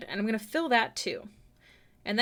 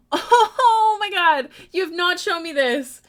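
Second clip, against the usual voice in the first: excited; 9 semitones higher, 10 dB louder, slower than usual. Real, not acted. Gasps at viewer's artwork.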